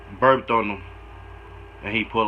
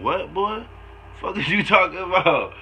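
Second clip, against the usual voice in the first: disbelieving; 5 semitones higher than usual. Real, not acted. Listener reacts in disbelief to host.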